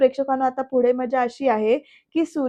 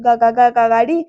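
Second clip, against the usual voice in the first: loud, stuttering disbelief; shocked stammer; 8 dB louder than usual. Acted. Uncle hears of a girl's vehicle, stammering in shock. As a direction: trip loudly over the first syllable in surprise.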